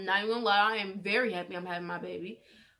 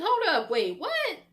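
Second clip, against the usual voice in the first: much higher and louder, a startled hold up; stunned outrage; 11 semitones higher, 6 dB louder, slower than usual. Retold or imitated. Daughter hearing stepdad demand an abortion, stunned. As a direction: stop everything, you can't believe he said it.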